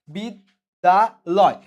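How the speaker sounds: বিদ্যালয় joined back as one slow, drawn-out word; whole word again. Shown as a demonstration. Teacher rejoining the syllables of বিদ্যালয় for students, slow whole word. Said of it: "এখানে আমার অক্ষর কয়টা আছে?".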